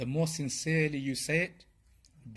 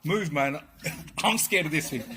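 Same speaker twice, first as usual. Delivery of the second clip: a hint of a frightened voice, a bit louder; mock scared plea; 3 semitones higher, 5 dB louder than usual. Speaker acting a frightened person who wants someone to move, mildly scared. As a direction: play the scared one pushing his friend along.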